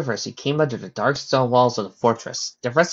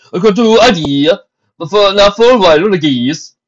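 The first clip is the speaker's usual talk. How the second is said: loud, raised-pitch stallion voice; other stallion soldier; 9 semitones higher, 12 dB louder than usual. Acted. Stallion soldier replying to his fellow, loud and high. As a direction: answer loudly in a higher character voice.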